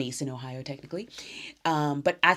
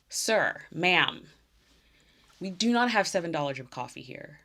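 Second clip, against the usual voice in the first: mock outrage; 6 semitones higher, 3 dB louder than usual. Real, not acted. Indignant rant about prices.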